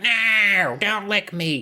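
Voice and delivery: high, drawn-out whine on "No"; mock scared gnome. Gnomes terrified of the dog, high mock-scared wail. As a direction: play a frightened gnome in a high cartoon voice. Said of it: "so fucking scared of Buster".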